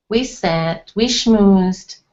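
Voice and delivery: ordinary delivery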